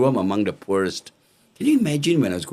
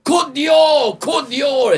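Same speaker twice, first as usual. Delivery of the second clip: high, loud shouted brand call; proudly shouting his own brand at the stall; hawker shout; 6 dB louder, much slower than usual. Retold or imitated. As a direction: shout the brand name to a market crowd. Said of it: "I was selling".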